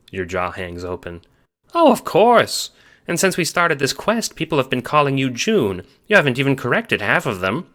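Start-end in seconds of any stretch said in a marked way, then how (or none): 1.7-7.7 put-on high, louder old grandma voice; amused grandma, pitched high; Nana's voice. A narrator's reading. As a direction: gush like a cheerful grandmother. Nana voiced in the story.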